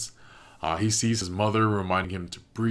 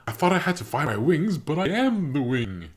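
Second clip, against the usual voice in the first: proud Callum; 7 semitones higher, 4 dB louder than usual. Retold or imitated. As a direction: deliver it like a big epiphany. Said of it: "he's like".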